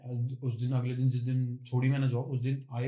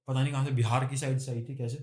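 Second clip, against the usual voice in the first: normal talking voice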